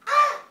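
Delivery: high, loud human caw, not quite the bird; attempted raven caw. Boy answering the raven, rough first attempt at a caw. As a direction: try to copy a raven's call. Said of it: "So I tried again".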